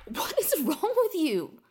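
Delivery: loud, slow, incredulous; exasperated shout. Acted. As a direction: demand it, outraged. Finds shrine, exasperated.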